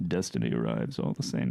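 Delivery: very low, quiet villain voice; deep menacing Thanos line. Acted. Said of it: "This is still Thanos".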